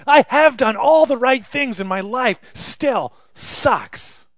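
loud then drawn-out sigh; exasperated reply as Job; frustrated cry; Job's retort. Acted. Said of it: "Job answering his friends".

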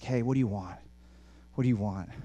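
ordinary talk, nothing marked

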